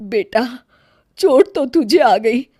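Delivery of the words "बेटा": ordinary talk, nothing marked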